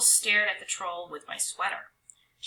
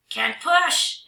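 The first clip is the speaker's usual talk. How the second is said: loud higher troll cry; 8 semitones higher, 8 dB louder than usual. Acted. Trolls refuse to push, loud and high. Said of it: "they cried".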